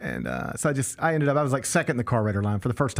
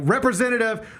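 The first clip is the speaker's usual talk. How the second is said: loud repeated demand into the phone; yelling at a phone menu; yelling representative; 10 semitones higher, 3 dB louder than usual. Retold or imitated. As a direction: bark it at the automated line. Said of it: "I'm just yelling representative into the phone".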